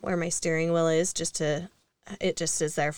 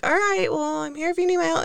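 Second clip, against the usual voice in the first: high-pitched mock polite stranger; stranger's reply; 11 semitones higher, faster than usual. The passerby offering to help, pitched up high. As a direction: lift the voice high, a bit unsure. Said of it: "And he's like".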